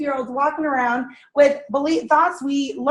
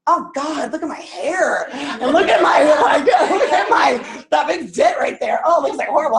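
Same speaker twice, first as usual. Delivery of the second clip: louder, laughing, dismayed griping at her own reflection; self-critical mirror moan; 3 semitones higher, 4 dB louder than usual. Retold or imitated. Picking apart her face in the mirror, laughing dismay. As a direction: groan at yourself in the mirror, half laughing. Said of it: "what do we do when we look in a mirror".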